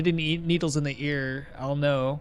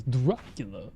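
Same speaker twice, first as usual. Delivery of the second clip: lightly put-on Dracula accent, lower; 5 semitones lower, 4 dB quieter than usual. Shown as a demonstration. Streamer naming the accent he attempts, mock Dracula accent. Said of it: "Dracula ass-, uh, accent".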